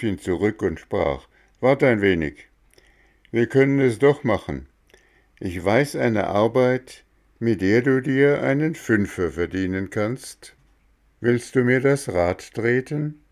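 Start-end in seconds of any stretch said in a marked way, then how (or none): 1.6-13.2 higher, louder gardener voice; lightly voiced gardener; Mexle's voice.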